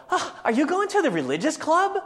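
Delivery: put-on very high, loud girly voice; hammed-up squeal of mocking disbelief; mocking schoolgirls. Girls walking by teasing a classmate, high-pitched mockery. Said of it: "the three girls walking by go".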